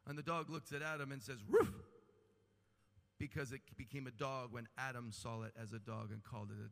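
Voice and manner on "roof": mock dog bark. Dog barks in story.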